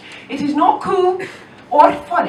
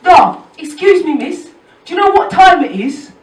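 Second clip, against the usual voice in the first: mock dopey bloke, louder and higher; imitating a dopey young man, louder and higher; 5 semitones higher, 10 dB louder than usual. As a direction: ham up a clueless lad asking the time. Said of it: "he says to me".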